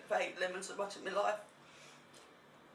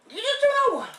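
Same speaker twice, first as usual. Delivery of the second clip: excited shout; 17 dB louder, much faster than usual. Real, not acted. Urging friend, loud and high.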